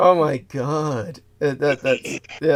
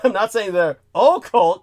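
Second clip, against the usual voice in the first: usual speaking voice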